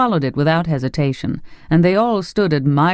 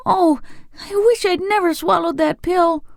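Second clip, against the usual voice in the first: pained wail. A narrator's reading. Boy in pain wishes.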